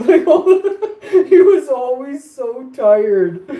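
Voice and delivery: high, slow, loud laughter squeezing the words; can barely get it out for laughing; laughing hard. Real, not acted. Remembering his always-tired friend, laughing hard.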